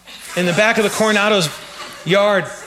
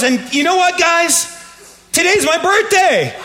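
excited announcement; 9 semitones higher, slower than usual. Retold or imitated. Newly baptized woman tells the crowd, high and excited. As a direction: savour the big news. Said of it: "she goes".

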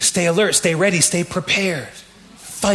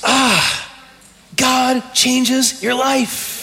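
emotional; 4 semitones higher, much slower than usual. Real, not acted. Pastor moved by his own conversion, slow and emotional. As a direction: let the feeling catch the words. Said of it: "I'm like".

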